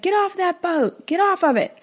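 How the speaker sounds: high-pitched mock yell, stopping short of a full shout; retold parental yell. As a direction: imitate a stressed father calling out a warning. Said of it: "he yells at him".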